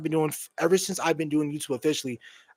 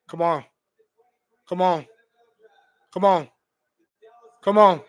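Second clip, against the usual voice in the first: from a mutter to a shout, growing each time; building come on; 4 dB louder than usual. Real, not acted. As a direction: chant it louder and louder as the pick nears. Rising chant awaiting the draft pick.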